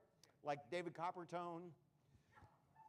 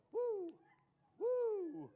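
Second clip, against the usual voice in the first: very high put-on "woo"; two falsetto whoops; 13 semitones higher, 4 dB louder than usual. Magician hamming up his trick, very high whoops. Demonstrates whooping stage patter.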